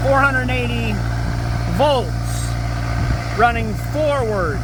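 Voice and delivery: loud, high, calling over engine noise. Real, not acted. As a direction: project over a roaring motor. Talking over a running engine.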